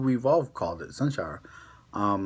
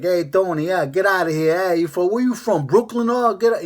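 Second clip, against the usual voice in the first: loud, high hammed-up New York street accent; 8 semitones higher, 7 dB louder than usual. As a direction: holler it like a pushy New Yorker, Rs dropped. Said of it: "you know, New York having that, like".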